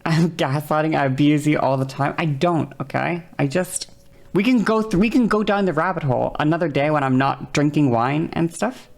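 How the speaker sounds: ordinary talk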